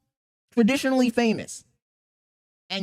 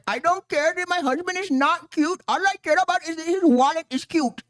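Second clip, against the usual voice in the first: high-pitched imitation of the wife; mock Indian wife; 7 semitones higher than usual. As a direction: mimic her in a put-on Indian accent. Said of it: "She said".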